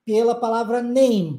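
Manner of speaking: ordinary delivery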